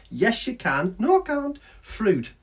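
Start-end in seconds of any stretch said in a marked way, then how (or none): 1.0-1.6 high, loud puppet voice; cheeky bird puppet voice; squeaky puppet bird. Acted.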